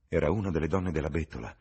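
plain reading voice

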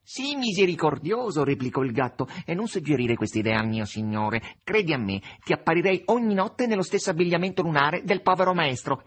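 wheedling cat begging for mercy; lightly voiced cat, half-mocking. The cat pleads and teases.

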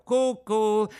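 high sing-song cucù teasing; cheeky puppet jeering cucù; mocking cuckoo. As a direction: taunt like a cuckoo clock. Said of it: "in segno di canzonatura".

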